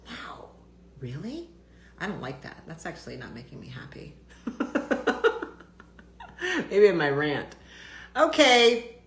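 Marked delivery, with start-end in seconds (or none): 4.2-6.6 a rueful airy laugh under her breath; breathy whispered laugh. Real, not acted. To a viewer comparing her voice to the nanny, a breathy rueful laugh. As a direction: laugh it off, almost silent.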